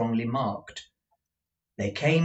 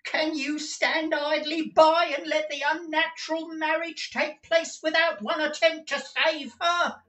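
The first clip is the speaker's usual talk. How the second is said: very high, strained pleading voice; agitated old clerk. Acted. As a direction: urge him to act, voice pitched up in distress. Newman's agitated voice.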